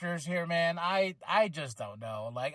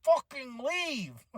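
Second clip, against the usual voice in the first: high, drawn-out begging; exasperated plea; 6 semitones higher, much slower than usual. Retold or imitated. Fan telling Braun to leave, high exasperated plea. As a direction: plead with him, worn out. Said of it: "I was like".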